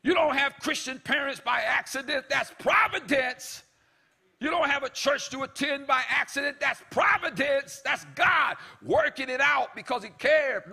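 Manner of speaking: loud, high shouted preaching; full-force preaching. Acted. Preacher shouting to the congregation about providence.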